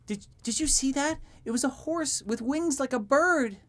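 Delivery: put-on high, loud, excited child voice; excited little girl. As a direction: gush like an amazed child. Child character voice.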